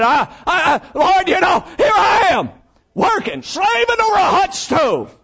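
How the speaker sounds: high, loud, overwrought complaining Martha. Acted. Martha shouting her complaint to the Lord, high and loud. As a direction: wail it to the Lord like a fed-up cook. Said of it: "She said".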